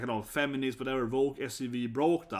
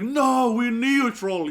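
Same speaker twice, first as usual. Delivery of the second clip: high, loud mock denial; hammed-up panicked no; mock protest; 8 semitones higher, 9 dB louder, much slower than usual. Imitating the classmate denying Sweden sells weapons, mock panicked protest. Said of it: "she had, like, a mental breakdown".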